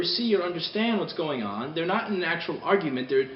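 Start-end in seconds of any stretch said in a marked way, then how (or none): none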